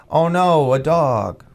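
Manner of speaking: a lightly voiced child reading a scared line without feeling; mock kids' wooden line. Telling a radio host how the kids in the play said their line, imitating them lightly. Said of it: "they just couldn't get it".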